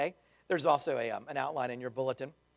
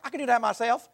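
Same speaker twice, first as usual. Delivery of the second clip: higher, louder imitation of a little girl's thick Southern accent; imitating a thick Southern accent, a touch higher and louder; 7 semitones higher, 7 dB louder than usual. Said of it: "this super thick Southern accent".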